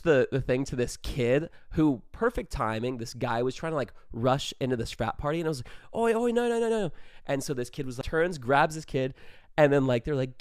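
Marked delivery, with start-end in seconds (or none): 5.9-6.9 high mock warning shout; calling out. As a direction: stop a gatecrasher in his tracks. Stopping someone rushing into party.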